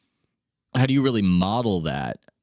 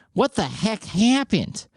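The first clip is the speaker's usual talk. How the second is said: hammed-up nasal Midwestern twang, pitched way up; mock Midwestern accent; 12 semitones higher than usual. Host mocking a big-game announcer, nasal Midwestern accent. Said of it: "something about the Midwestern accent, a little too nasal".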